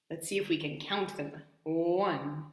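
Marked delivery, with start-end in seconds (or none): none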